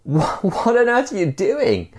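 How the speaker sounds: high-pitched, stammering shout of disbelief; acted shout to someone below; startled shout. A narrator's reading. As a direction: call out high, stumbling on the first word. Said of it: "And he shouts".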